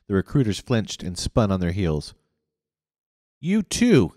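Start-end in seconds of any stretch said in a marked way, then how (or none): 3.4-4.1 high, loud angry snarl. A narrator's reading. Leader snarls at the guardhouse recruiters. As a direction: snap the order at them. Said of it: "he snarled at the recruiters".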